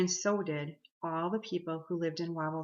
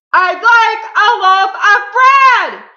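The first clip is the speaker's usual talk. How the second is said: very loud, high, drawn-out holler; booming shout; 22 dB louder, slower than usual. A narrator's reading. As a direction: boom it out at full volume. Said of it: "he boomed".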